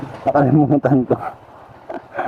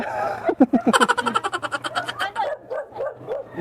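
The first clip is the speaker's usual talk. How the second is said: long high giggle; high laugh; 8 dB quieter than usual. Real, not acted. Laughing at forgetting.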